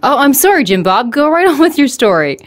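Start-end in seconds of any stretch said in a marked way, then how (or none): none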